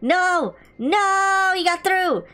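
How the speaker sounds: shouted no. Real, not acted. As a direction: yell no as the wave breaks through. Losing a wave in game.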